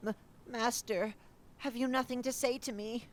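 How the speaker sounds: high, stammering desperate plea; pleading creature. Acted. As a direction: beg the silent master to answer. Creature pleads with silent master.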